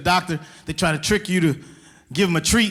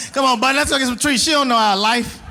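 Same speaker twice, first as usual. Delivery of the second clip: coaxing dog; 7 semitones higher than usual. Retold or imitated. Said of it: "I said".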